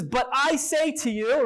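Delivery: slow, weighty "But I say", leaning on "I"; emphatic stress. Shown as a demonstration. Teacher quoting the verse's emphasis to the class, slow stress on "I". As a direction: stretch out "But I say to you", stressing "I". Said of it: "the emphasis".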